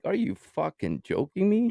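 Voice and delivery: can't believe what the cops just said; incredulous outrage. Retold or imitated. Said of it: "I'm like".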